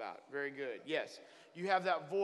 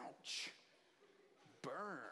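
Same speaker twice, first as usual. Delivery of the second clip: quiet mock ouch; 14 dB quieter than usual. Stung by God's rebuke.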